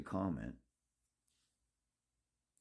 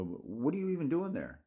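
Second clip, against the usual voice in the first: exasperated scolding; 6 semitones higher than usual. Acted. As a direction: scold the sulker, pitching higher as it builds. Scolding the imagined sulking boxer.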